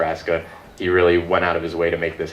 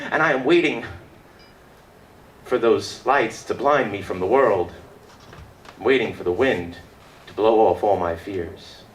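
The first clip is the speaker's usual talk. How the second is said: poetry recital winding down; 3 semitones higher than usual. Acted. Recites own poem on stage.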